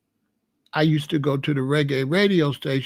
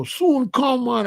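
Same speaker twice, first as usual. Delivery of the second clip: much higher, lilting Jamaican accent; mock Jamaican accent; 8 semitones higher, slower than usual. Shown as a demonstration. Showing listeners what he avoids, high Jamaican accent. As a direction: exaggerate it like a Jamaican. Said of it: "But I don't go".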